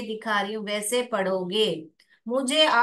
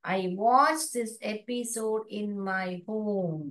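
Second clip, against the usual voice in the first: very slow, word by word; slow model reading; 2 semitones lower, much slower than usual. Shown as a demonstration. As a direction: read the sentence slowly for kids. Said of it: "model reading". (धीरे-धीरे वाक्य पढ़ना)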